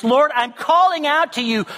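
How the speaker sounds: desperate man crying out for help; earnest cry. Retold or imitated. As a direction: call out to God in real distress. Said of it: "this sense of earnestness".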